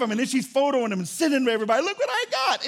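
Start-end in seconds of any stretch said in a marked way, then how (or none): 1.8-2.7 high-pitched showing-off squeal; mock screaming boast. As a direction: squeal it like bragging to the world. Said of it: "It's like screaming to the world".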